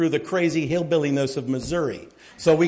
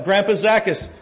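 normal talking voice

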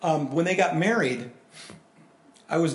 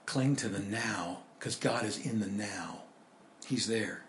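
hushed urging; 7 semitones lower, 9 dB quieter than usual. Real, not acted. Tender close of the point.

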